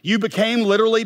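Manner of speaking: ordinary talk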